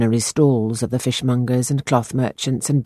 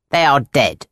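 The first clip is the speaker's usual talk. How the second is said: fortune teller. A narrator's reading. Fortune-teller voiced in story.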